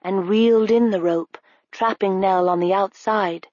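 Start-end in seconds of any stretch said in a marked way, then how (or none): none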